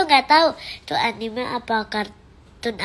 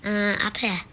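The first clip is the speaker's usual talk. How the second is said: normal talking voice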